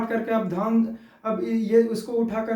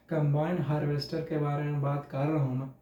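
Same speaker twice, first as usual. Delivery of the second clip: low, soft recitation; demonstrated reading style; 6 semitones lower, 6 dB quieter than usual. Shown as a demonstration. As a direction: drop the voice to model reading aloud. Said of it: "फिर ऐसे पढ़ना है". (नीची, धीमी आवाज़ में पढ़कर दिखाना)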